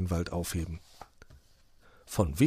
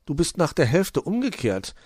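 higher, louder reproach; pitched-up critical remark; 8 semitones higher, 5 dB louder than usual. A narrator's reading. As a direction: point it out critically. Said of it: "bemerkte Luzi kritisch".

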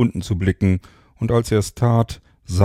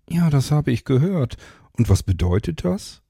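higher, lightly voiced impatient woman; a bit sceptical, pitched up; Mara's voice; 7 semitones higher than usual. A narrator's reading. Mara asking Rodriguez what he means, lightly higher.

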